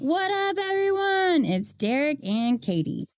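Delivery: high, drawn-out sing-song greeting; own podcast intro. Shown as a demonstration. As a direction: stretch the greeting high, then slow on the names. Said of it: "you can do it like you".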